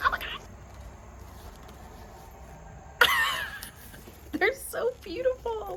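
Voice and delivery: very high squeal with a breathy laugh; squealing delight. Real, not acted. Squealing with delight at the friend's handmade books. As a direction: squeal with joy over the books. Overwhelmed by the finished set.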